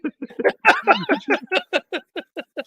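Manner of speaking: shrieking laugh at the memory; real high cackle of laughter; high squealing laugh. Real, not acted. Laughing at own story.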